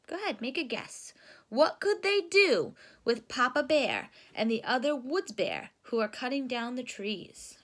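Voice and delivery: usual speaking voice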